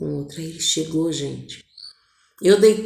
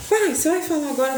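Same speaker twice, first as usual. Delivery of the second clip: a hint of a higher, quick kid voice; curious child; 8 semitones higher, faster than usual. Children ask father if he'll talk now, lightly childlike. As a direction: lightly play an eager child. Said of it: "aí os três na sala".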